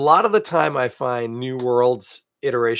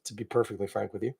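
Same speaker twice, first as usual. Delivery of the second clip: ordinary talk, nothing marked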